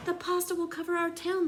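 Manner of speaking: slightly high frightened cry; a touch of fear; mild crying out. A narrator's reading. Townspeople fearing the pasta flood, mildly frightened. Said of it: "they cried".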